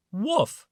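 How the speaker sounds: high bark; dog woof. A narrator's reading. Reading to children, a high dog woof. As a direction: yap like a little dog. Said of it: "said the dog".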